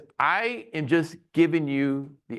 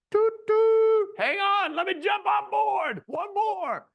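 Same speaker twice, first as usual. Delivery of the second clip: train conductor call; slower than usual. Retold or imitated. Narrator joking about boarding the sinking newspaper, mock conductor call. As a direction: call out all aboard, hammed-up.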